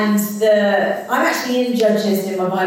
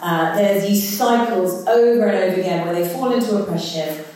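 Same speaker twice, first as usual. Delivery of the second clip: ordinary talk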